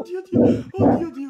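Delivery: high laughing cry; laughing oddio. Real, not acted. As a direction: squeal it through laughter. Laughing exclamation.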